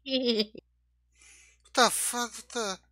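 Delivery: high, very slow witch voice; witchy Crumb impression. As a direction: drag it out like a cackling witch. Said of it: "That sound like a witch".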